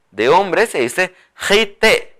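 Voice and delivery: jite with a strong raspy j; slow teaching dictation. Shown as a demonstration. Teacher dictates the new word slowly to the class. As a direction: stretch it out, stress jite. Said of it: "suena fuerte".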